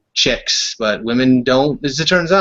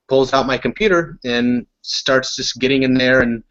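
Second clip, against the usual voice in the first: ordinary delivery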